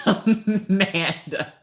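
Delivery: high, drawn-out name through laughter; amused, high-pitched exclamation; laughing. Real, not acted. Laughing at Amanda and her chair.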